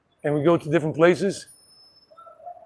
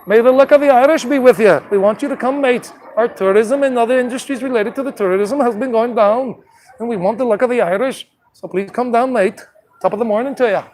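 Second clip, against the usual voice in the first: hammed-up, higher-pitched Irish brogue; playful mock-Irish voice, pitched up; Irish accent; 5 semitones higher than usual. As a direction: put on an amateur Irish accent. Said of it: "I can't really do an Irish accent".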